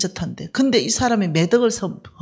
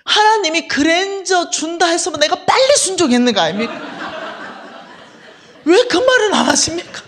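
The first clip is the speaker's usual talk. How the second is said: much higher, louder, laughing through it; laughing protest; 9 semitones higher, 5 dB louder than usual. Pretend. Teasing God about the promised car, laughing.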